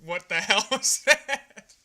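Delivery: loud, slow, breaking into laughter; laughing disbelief. Retold or imitated. Recalling cracking up at the film, laughing disbelief. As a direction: crack up midway through the question.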